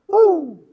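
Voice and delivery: mock siren whoop, high and loud; siren woo. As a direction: imitate a police siren sharply. Imitating the sheriff siren.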